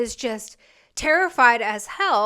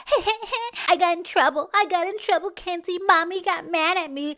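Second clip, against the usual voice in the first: higher-pitched, giggly mockery of a dad; mocking wimpy dad; 6 semitones higher than usual. Dad sheepishly confessing to his daughter on the phone, mocking higher voice. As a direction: ridicule him as a childish tattling pansy. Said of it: "What kind of pansy is on the phone with his daughter? Like".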